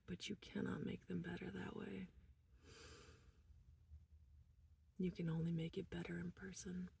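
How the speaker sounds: hushed whisper. Real, not acted. A mother confiding to viewers in a whisper. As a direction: whisper it, almost no voice.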